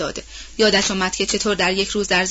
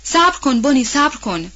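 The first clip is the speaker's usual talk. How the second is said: higher, louder cry of alarm; panicked cry; 4 semitones higher, 3 dB louder than usual. Acted. Mother crying out to her daughter on the horse, panicked cry. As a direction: call out in fear to stop her. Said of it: "بی اختیار دو بار فریاد زد".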